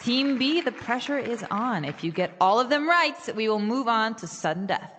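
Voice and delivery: brisk quiz-host announcing; game show host building the pressure on the teams. Acted. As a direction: run the tiebreaker like a TV host. Host runs quiz tiebreaker skit.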